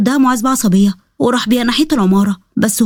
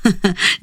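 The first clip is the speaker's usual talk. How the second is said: gloating acted laugh; mocking laugh; 2 semitones lower, 3 dB quieter than usual. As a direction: laugh at the victim's plea. Villain mocks his victim's pleading.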